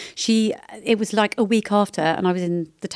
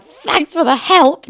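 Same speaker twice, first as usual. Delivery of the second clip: sharp, loud and high, slowly spelled out; loud sarcastic retort; 9 semitones higher, 10 dB louder, much slower than usual. Retold or imitated. Mum loudly calling out passers-by who ignored her. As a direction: fling it loudly at the men walking past. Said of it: "not under my breath, very loudly".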